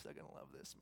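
conspiratorial whisper; scheming aside, whispered; gleeful whisper. Retold or imitated. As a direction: whisper it like a private joke. Joseph scheming with confidants.

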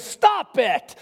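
high, forceful command; commanding shout. Retold or imitated. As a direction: order the storm to stop. Jesus commands the storm.

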